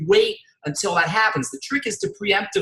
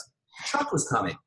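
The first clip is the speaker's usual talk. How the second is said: low, slow and softer; deadpan frog punchline; 4 semitones lower, 6 dB quieter than usual. Retold or imitated. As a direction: drop the punchline quietly as the frog. Frog delivers the joke's punchline.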